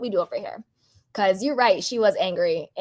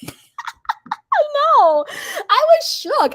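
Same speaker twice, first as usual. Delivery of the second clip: high, loud laughing disbelief; shocked laughing squeal; 6 dB louder, much slower than usual. Real, not acted. Host laughing at a viewer's angry comment, high shocked squeal.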